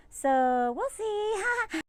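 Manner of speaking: very high, drawn-out Southern lady voice; sweetly noncommittal Southern belle; mock Southern wife.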